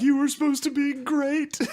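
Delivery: very high-pitched wail through laughter; mock crying mom. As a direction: wail it like a heartbroken mom, hammed up. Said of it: "she started to cry".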